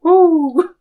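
high, loud woo; mock celebration. Pretend pilots steering the planet, mock celebration. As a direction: let out a playful victory whoop.

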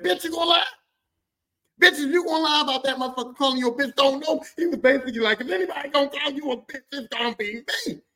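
high, loud, outraged tirade; acted angry husband; mad dad. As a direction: rant at her in indignant fury. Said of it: "I could tell that he was mad".

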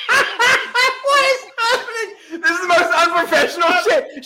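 cracking up and yelling at the chaos; laughing shout. Real, not acted. Host yelling at the child who crashed the set, laughing shout.

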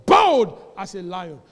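booming, slowed proclamation; loud slow declaration. Shown as a demonstration. Preacher answering his own question, booming and slow. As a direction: thunder out each word slowly. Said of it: "Bible said the righteous is as what?".

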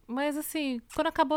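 usual speaking voice

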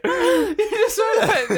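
high, laughing and teasing. Real, not acted. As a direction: push it out through laughter. Laughing through the tease.

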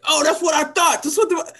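smug taunt. Retold or imitated. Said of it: "then said".

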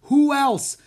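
high, loud outburst; exasperated shout. A narrator's reading. Theater manager answers a silly question, shouting. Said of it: "shouted Mister Dewey".

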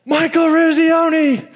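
high, full-voiced shout of his own name; yelled name. Exhausted player shouting his name to coach, all-out yell. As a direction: shout it with all your strength. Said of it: "He just yells".

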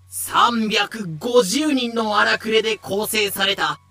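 ordinary delivery

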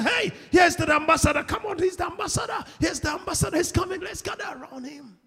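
high-pitched, acted calling out; hammed-up excited herald voice; excited angels. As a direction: call the others over in a rush. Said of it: "They run and say".

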